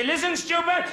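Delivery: high, loud mock bullying teacher; hammed-up nasty schoolmaster; sneering teacher. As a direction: bark it at the pupil. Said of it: "He used to say".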